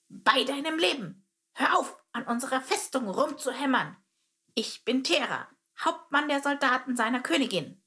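high, clipped termite captain barking orders; a soldier captain warning off an intruder; curt military voice. Acted. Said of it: "militärisch knappe Stimme".

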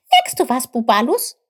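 high, loud excited question; excited call. A narrator's reading. Chip alerts Bubalus to his discovery, excited high call. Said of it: "rief Chip aufgeregt".

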